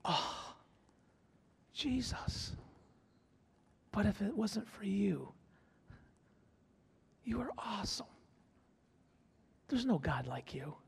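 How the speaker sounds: soft, awed whispered prayer; a hushed night prayer to Jesus; bedtime whisper. Retold or imitated. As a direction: whisper your awe before sleep. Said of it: "When you go to bed at night".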